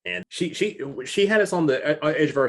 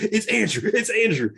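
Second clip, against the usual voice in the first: much higher, thrilled squeal of recognition; excited; 13 semitones higher, 3 dB louder than usual. Retold or imitated. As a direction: gasp it out in delight. Speaker excitedly recognizing actor.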